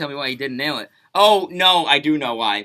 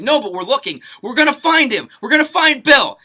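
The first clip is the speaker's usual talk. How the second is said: building higher and louder to an eager vow; 8 semitones higher, 9 dB louder than usual. Retold or imitated. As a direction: pump it up, rising with determination. Strangers vow to find Bill.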